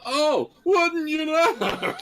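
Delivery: high, slow words through a laugh. Real, not acted. Laughing at missing skill.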